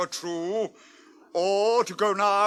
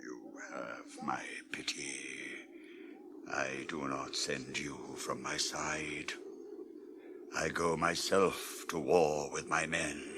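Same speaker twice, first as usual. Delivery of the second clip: much lower, much softer, near whisper; quiet and low; 12 dB quieter than usual. Acted. King answering his pleading counselor, low and quiet. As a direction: hold it down, calm and grave.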